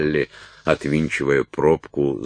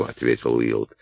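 plain reading voice

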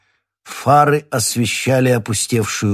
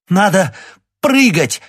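loud, much higher, out of breath; breathless shout; 11 semitones higher, 6 dB louder than usual. A narrator's reading. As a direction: gasp it out as a yell over wind. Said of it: "задыхаясь, крикнула Лиза".

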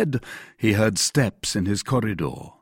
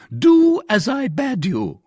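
high, loud, slow command; crying out; 13 semitones higher, 6 dB louder, slower than usual. A narrator's reading. Commissary overruling Athos, crying out angrily. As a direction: cry the order out sharply. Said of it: "cried the commissary".

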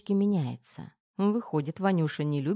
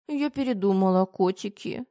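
higher, pitiful plea; plaintive pleading; 5 semitones higher than usual. A narrator's reading. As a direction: beg pitifully, acting helpless. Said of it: "жалобно взмолилась Тома". (жалобная мольба)

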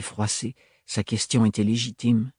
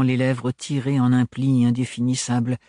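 usual speaking voice

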